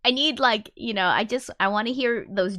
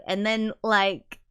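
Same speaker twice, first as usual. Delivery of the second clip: ordinary delivery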